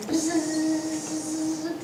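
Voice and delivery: soft imitated buzzing of a fly. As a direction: buzz quietly like a fly. Fly lands on his noodles.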